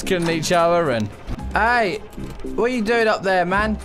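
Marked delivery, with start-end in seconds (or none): none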